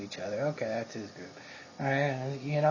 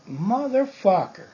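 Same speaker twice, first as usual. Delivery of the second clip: slow, drawn-out, louder curse, pitched up; stunned realization; 8 semitones higher, 6 dB louder than usual. Retold or imitated. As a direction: realize who just walked in. Recognizing the guy, exclaiming.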